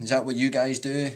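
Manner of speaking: usual speaking voice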